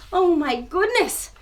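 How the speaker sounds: usual speaking voice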